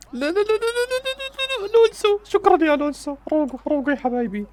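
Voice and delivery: rapid very high stream of no's easing into pleading; panicked squeal. Real, not acted. Fan panicking as his defence is threatened, shrieky pleading. Panic at danger near goal.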